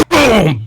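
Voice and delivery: high, falling "boom"; imitated impact. Storyteller imitates chair hitting head, imitated impact. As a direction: voice the blow of a chair.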